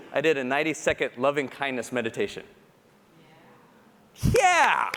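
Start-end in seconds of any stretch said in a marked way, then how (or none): 4.3-4.9 high, loud yell of victory; real little whoop of pride; triumphant cheer. Real, not acted. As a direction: punch the air with it. Cheering own achievement.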